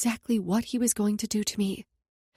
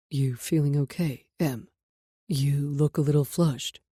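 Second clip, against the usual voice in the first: deep male voice; 7 semitones lower than usual. Acted. Nate's deep voice.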